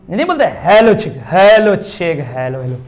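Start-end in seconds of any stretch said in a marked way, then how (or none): none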